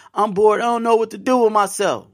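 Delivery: high, whiny bored complaint; mock whiny bored voice.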